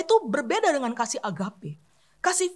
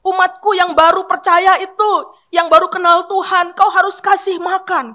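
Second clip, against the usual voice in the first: louder, driving exhortation; urgent preaching; 4 semitones higher, 9 dB louder than usual. Real, not acted. Preacher urging the congregation to feed new believers, loud and driving. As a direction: press the charge on the church.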